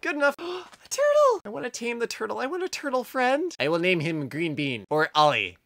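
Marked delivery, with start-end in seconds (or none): 0.0-3.5 gasp, then high-pitched delight; excited squeal. Real, not acted. Player finds a turtle to befriend, excited squeal.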